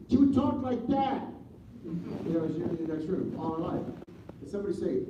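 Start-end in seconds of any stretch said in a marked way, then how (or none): 0.1-1.3 high, slow, pointed rebuke; a mother shutting down a slur; mother's scolding. Retold or imitated. Mother warning her son, a high pointed rebuke. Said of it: "my mother would say".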